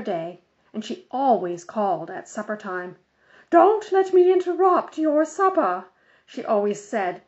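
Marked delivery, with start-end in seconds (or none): 3.5-5.8 high, loud bossy lady's voice; fussy Mrs Armstrong. A narrator's reading. Busybody on the phone lecturing Mother, high and loud. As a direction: play the busybody organizer insisting.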